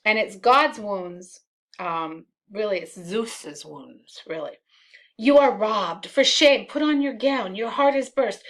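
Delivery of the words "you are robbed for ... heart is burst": urgent alarm. Acted. Iago rousing Brabantio with alarm.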